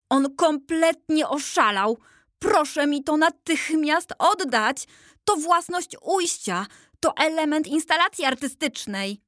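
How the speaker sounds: high, urgent demanding voice; insist, upset and shrill-high; indignant boy. A narrator's reading. Insurgent boy demands the statues return the piece, high and indignant.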